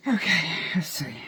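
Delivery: whispered to herself; whispering. Real, not acted. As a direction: breathe it under your breath. Muttering to herself.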